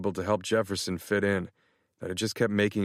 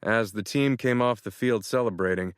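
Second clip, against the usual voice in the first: ordinary delivery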